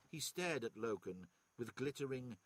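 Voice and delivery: usual speaking voice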